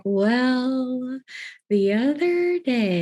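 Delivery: exaggerated, drawn-out slow speech; slow as molasses. Cohost mocks own careful speech, slow as molasses. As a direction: stretch each word like pouring molasses. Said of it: "as slow as molasses".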